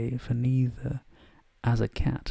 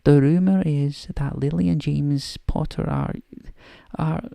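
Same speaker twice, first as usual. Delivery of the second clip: put-on higher, louder Scottish accent for a stern professor; a slightly overdone Scottish accent, briskly; Scottish McGonagall; 5 semitones higher, 5 dB louder than usual. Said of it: "almost bad Scottish accent".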